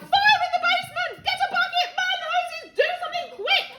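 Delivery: high, loud frantic orders; frantic yelling. Acted. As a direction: shout for help in a panic. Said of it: "the old woman yelled".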